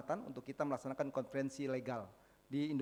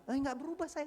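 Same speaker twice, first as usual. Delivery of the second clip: imitating her in a high-pitched voice; high mock girlfriend voice; girlfriend's reply; 12 semitones higher than usual. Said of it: "kata pacarnya".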